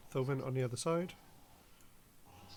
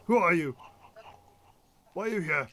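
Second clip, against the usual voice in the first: gruff guard; 3 semitones higher, 7 dB louder than usual. Acted. GM voices suspicious dwarf guard.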